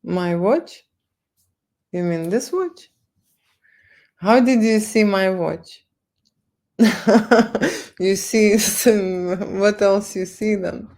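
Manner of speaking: normal talking voice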